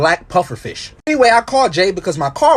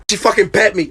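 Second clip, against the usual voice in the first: normal talking voice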